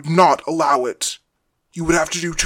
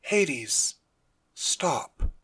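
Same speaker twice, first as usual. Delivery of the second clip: much softer, normal tone; quiet, without anger; 9 dB quieter than usual. Acted. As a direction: drop to a quiet, even request. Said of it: "he said in a normal tone of voice, quiet, without anger".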